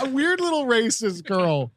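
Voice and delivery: giggling through the words; laughing. Real, not acted. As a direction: laugh while repeating it. Repeats line laughing.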